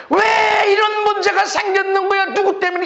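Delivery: high, insistent blaming whine; hammed-up grievance; mock complaining. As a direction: mimic someone demanding whose fault it is. Mimics complaining about problems.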